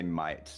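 "might" standing in for an Aussie mate; Australian vowel. Shown as a demonstration. Teaching friends the Aussie greeting piece by piece, "might" for mate. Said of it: "the way they say to do it".

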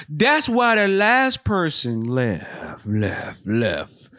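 slow, ominous movie-trailer voice with a fading echo; hammed-up horror trailer, drawn out and echoing away. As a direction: intone it like magic words of doom. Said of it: "A Blumhouse production".

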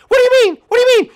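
very high, loud squeal; protesting little recruit. Acted.

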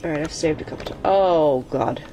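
normal talking voice

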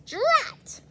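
high witchy drat; witch voice. Acted. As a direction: spit it like a cranky witch. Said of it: "But she says".

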